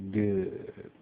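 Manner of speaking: soft, private aside; under his breath. A narrator's reading. Hate to himself about confused World, under his breath. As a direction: drop it to a quiet murmur. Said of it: "Under his breath".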